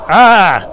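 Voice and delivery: short, loud cry as snow hits the face; startled yelp. Acted. Struck in the face by a snowball, a loud startled yelp. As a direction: yelp in surprise.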